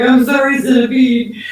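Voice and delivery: high, loud mock-plaintive apology; retold remorseful apology. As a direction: plead with the bug, playing up the guilt. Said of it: "And I felt so bad".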